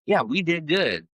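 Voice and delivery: mock-proud, high, drawn-out imitation; self-congratulating therapist. Therapist taking credit for a client's progress, mock self-congratulation. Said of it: "you'll see therapists and be like".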